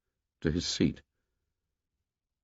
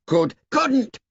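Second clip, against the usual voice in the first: put-on arguing boy. A narrator's reading. Boys arguing.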